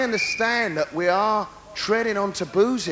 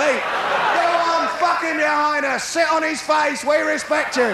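crowd heckle; 7 semitones higher, slower than usual. Pretend. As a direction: shout up at the balcony like a rowdy fan. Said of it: "That's what it's gonna be".

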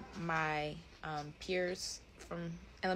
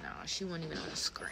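quick unvoiced whisper; hushed aside, barely voiced; 5 dB quieter, faster than usual. Real, not acted. Whispering an aside to the person filming.

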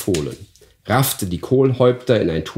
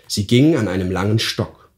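ordinary delivery

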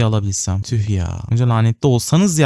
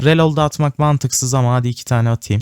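normal talking voice

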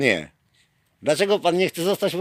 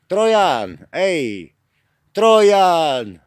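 louder call of the nickname; 4 dB louder, much slower than usual. Real, not acted. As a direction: shout him over. Calling officer by nickname. (głośne wołanie)

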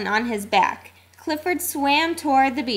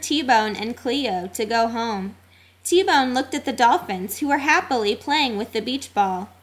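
ordinary delivery

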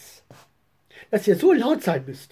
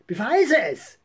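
plain reading voice

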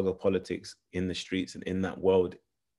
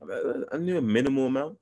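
ordinary delivery

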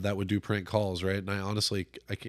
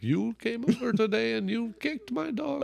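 high, put-on Middle Eastern accent; 11 semitones higher, 3 dB quieter than usual. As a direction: complain like an angry neighbor, Middle Eastern accent. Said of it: "he does the Middle Eastern accent".

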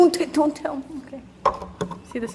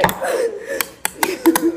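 high giggle; 12 semitones higher than usual. Real, not acted. Laughs after tasting.

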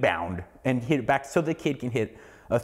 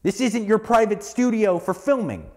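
retold telling-off; 7 semitones higher than usual. To the gym guy who yelled at the girl, a heated retold yell. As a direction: scold him hard, reliving the moment. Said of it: "I went over, I was like".

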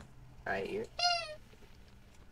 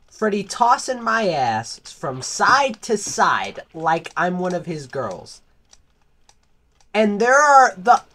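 loud, outraged ranting; loud, aggrieved rant at the villagers who ignored his cries; indignant loud rant; 6 semitones higher, 12 dB louder than usual. Acted. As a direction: yell your grievance at the bystanders.

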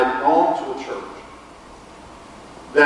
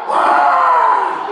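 shocked; 4 dB louder than usual. Retold or imitated. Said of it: "And I'm like".

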